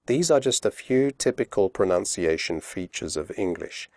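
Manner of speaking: ordinary talk, nothing marked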